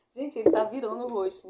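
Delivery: can't hold back the giggles; laughing. Real, not acted. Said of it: "desculpa, eu tive que rir". (rindo de verdade)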